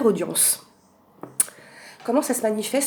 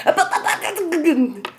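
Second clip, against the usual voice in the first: laughing shoo; 9 semitones higher, 5 dB louder, slower than usual. Real, not acted. Shooing friend out laughing.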